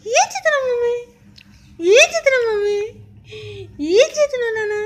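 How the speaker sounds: put-on high, loud cooing questions to a baby; sing-song baby talk. Mother coos high to her baby, asking what she's doing. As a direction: coo up high at the little one.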